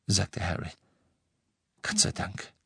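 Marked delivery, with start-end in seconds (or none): 1.8-2.5 soft, airy sigh of relief; hushed relief; relieved whisper. A narrator's reading. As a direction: breathe it out in relief. Ginny's relief at news.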